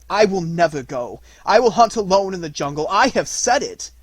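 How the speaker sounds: put-on boy vowing loudly to the dying wolf; defiant refusal. A narrator's reading. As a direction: refuse him outright.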